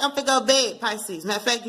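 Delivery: ordinary delivery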